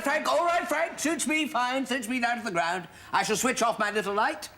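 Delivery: injured, huffy acceptance. Acted. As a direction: sulk like a snubbed prompter. Prompter sulking after rebuke.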